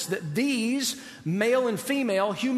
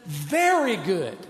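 delighted approval; 2 semitones lower, much slower than usual. Retold or imitated. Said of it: "And he says".

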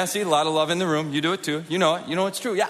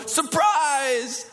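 surprise shout; 10 semitones higher than usual. Retold or imitated. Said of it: "jump out from behind the bush and go".

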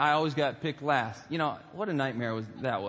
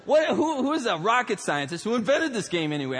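higher, emphatic incredulous demand; mock outrage; 7 semitones higher, 4 dB louder than usual. Comic storyteller questions who invented dodgeball, mock outrage. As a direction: demand to know who's to blame, hammed up.

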